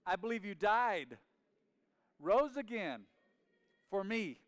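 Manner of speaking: loud, high prayer in separated phrases; leading prayer aloud. Real, not acted. Preacher leading everyone in prayer together, loud and fervent. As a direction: proclaim each phrase for repeating.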